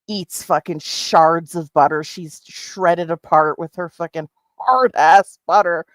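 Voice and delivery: ordinary talk, nothing marked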